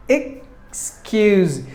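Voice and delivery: "Excuse" in two separated syllables. Shown as a demonstration. Teacher repeating the breakdown for learners, "Excuse" split in two. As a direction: pause after the first syllable of "Excuse". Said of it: "There are two syllables".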